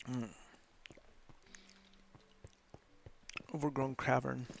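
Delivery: talking while chewing; eating candy while talking; mouth full. Real, not acted. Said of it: "I just get tired eating this stuff".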